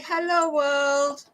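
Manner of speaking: high, slow cat voice showing off; a preening cat saying hello to the world; voicing the cat. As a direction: owner speaking for her cat, high and slow. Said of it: "You say".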